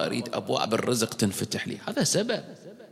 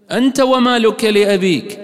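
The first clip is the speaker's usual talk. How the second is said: emphatic quote; 9 semitones higher, 6 dB louder than usual. Shown as a demonstration. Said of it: "repeats the hadith louder".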